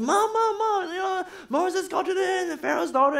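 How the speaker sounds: put-on high, breathless child calling mom; a little girl bursting with news; excited girl. Sister runs home excited.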